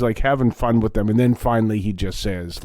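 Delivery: normal talking voice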